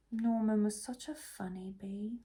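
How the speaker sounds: wistful whispered sigh. Acted. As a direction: breathe it out missing him. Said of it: "sighed the bees".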